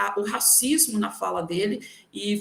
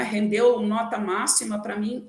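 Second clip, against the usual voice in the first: ordinary talk, nothing marked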